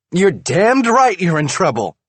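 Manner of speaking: high, loud angry retort. A narrator's reading. Wife retorts angrily to husband. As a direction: snap it back.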